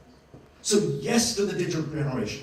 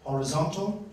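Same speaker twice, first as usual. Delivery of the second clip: ordinary talk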